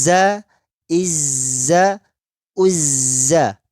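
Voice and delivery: za with a strong, continuous buzzing z; flowing zai. Shown as a demonstration. Teacher showing students the flowing zai. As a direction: let the z flow straight into the vowel. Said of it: "Harus mengalir".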